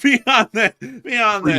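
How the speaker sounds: high, loud, breaking into laughter; laughing. Real, not acted. Host tries moving on from the joke, laughing. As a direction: fight through a laughing fit.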